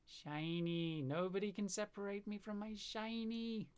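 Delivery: playful high, obsessive crooning; possessive gloating. Pretend. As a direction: coo over your treasure, pitch climbing. Gloating over favourite revolver.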